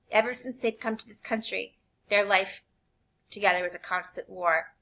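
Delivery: usual speaking voice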